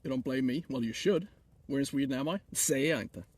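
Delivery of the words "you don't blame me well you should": normal talking voice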